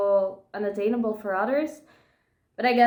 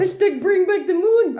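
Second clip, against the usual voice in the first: excited rallying cry; 9 semitones higher, 7 dB louder than usual. Acted. Fan rallying cry, loud and high.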